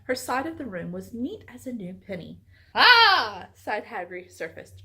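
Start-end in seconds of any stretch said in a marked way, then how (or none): 2.7-3.5 triumphant cry. A narrator's reading.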